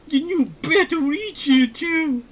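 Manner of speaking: extremely high, loud crazy scientist; piercingly high cartoon voice, near laughter; Larry. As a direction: push the squeaky scientist voice to the limit, slowly. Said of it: "I did a crazy voice for that guy".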